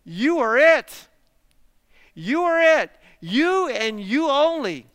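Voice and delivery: YOU drawn out, high and loud; slow, forceful declaration leaning hard on "You"; emphatic YOU. Shown as a demonstration. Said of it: "extra emphasis on the you".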